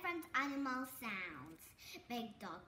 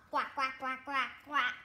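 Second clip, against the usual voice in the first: louder quacking; duck quack; 3 semitones lower, 6 dB louder than usual. Shown as a demonstration.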